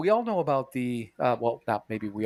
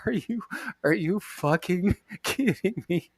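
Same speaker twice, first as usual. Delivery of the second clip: incredulous; 5 semitones higher, slower than usual. Retold or imitated. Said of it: "And I'm like".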